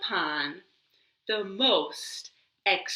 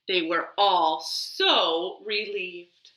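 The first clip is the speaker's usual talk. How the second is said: ordinary delivery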